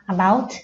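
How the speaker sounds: about with a clear final t and rising end; about, careful t at the close; final T sounded. Shown as a demonstration. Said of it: "ออกเสียงทอทหารด้วยนะคะ".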